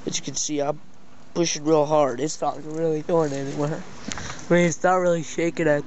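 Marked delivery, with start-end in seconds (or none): none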